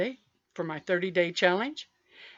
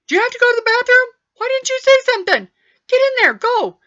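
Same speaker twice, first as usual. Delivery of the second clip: much higher, louder, fast scolding mom; mother yelling loud; 12 dB louder, faster than usual. Retold or imitated. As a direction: bark it across the store like an embarrassed mother. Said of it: "she'd say really loud".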